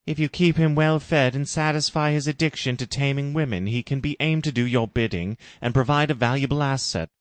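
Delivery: solemn Jim. Acted. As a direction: warn her as a grave mentor. Jim's long warning.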